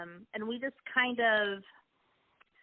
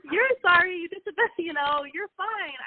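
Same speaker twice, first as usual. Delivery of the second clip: much higher and louder "You're sorry", disbelieving; incredulous reassurance; 10 semitones higher, 5 dB louder than usual. Retold or imitated. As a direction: pitch it way up, as if she can't believe he's apologizing. Wife reassuring husband in disbelief.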